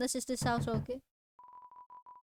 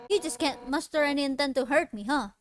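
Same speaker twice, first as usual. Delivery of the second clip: ordinary delivery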